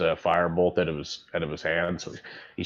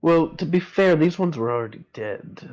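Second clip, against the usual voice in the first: put-on high, louder old man character voice; Edmund's voice; 10 semitones higher, 4 dB louder than usual. Edmund answering Fisker, high and wry.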